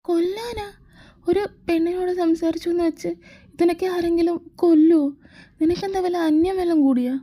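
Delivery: high, disbelieving squeal of protest; shocked disbelief. Acted. Girlfriend aghast at her jealous boyfriend's threat, pitched high.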